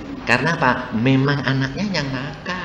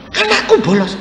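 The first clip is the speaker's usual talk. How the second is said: high, stunned question; a mother hearing bad news for the first time; shocked parent. Mother learns her son skips school, high and stunned.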